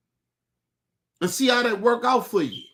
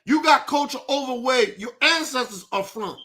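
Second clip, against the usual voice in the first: normal talking voice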